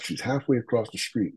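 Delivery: ordinary talk, nothing marked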